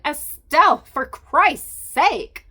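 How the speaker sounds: furious loud outburst, dragged slow; shout. Acted. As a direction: explode at her to make her stop. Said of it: "I didn't mean to shout".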